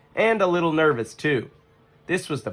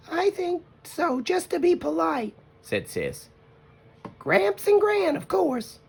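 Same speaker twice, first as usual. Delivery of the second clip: put-on much higher girlish cub voice; little sister voice; slower than usual. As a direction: sound eager and sweet.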